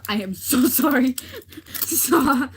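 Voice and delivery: loud, high laughing sorry; giggling loud apology, real. Real, not acted. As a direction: crack up while apologizing. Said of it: "laughing at own slip".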